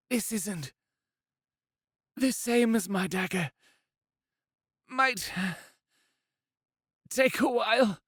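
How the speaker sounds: resolute injured woman. Acted. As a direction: speak through pain but stay firm.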